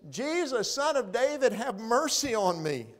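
high pleading cry for mercy; calling out. Acted. Blind Bartimaeus calling to Jesus over the crowd, pleading cry. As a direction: call out over the crowd, begging. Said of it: "He says".

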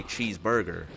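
American cheeseburger with sounded R's; put-on American pronunciation of cheeseburger; American accent. Shown as a demonstration. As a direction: imitate how Americans say cheeseburger. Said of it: "How you lot say it".

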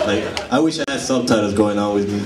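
ordinary talk

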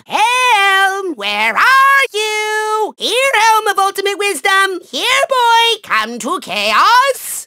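high, drawn-out searching call. Acted. Villain calling for the missing helm, drawn-out coaxing call. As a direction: call it like a lost dog.

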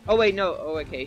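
ordinary talk

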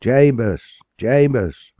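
high, loud slow calls across the water; crying out a name. Acted. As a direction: call the lost boy's name into the dark. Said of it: "Again and again he raised his voice".

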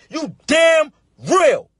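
slow, shouted you damn real; slow defiant yell. Real, not acted. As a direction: drag it out like a proclamation. Defiant answer to critics.

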